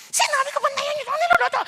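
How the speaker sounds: high, loud angry tirade; hammed-up screaming host; shouting scold. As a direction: yell a furious scolding at the household. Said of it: "Nagsisigaw yung hali".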